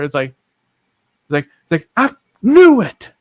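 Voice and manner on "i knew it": very high, loud, drawn-out crowing; triumphant outburst. Retold or imitated. Sister hears she'll be an aunt, crowing triumphantly. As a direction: imitate a sister shrieking that she guessed right.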